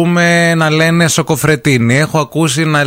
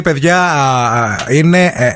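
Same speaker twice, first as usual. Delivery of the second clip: normal talking voice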